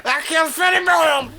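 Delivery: put-on high, cranky old veteran voice; boastful old soldier; Cotton Hill voice. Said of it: "That's Hank Hill. I mean, Cotton Hill".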